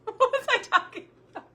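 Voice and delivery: laughing at losing her train of thought. Real, not acted. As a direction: laugh through the question. Laughing at herself.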